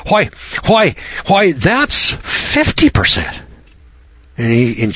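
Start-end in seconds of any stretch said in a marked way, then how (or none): 0.1-3.4 high, slow, stunned gasp of disbelief; shocked at the fifty percent, drawn out; gasping surprise. Retold or imitated. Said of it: "he gasped".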